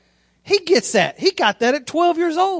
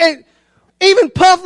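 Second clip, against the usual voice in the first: ordinary talk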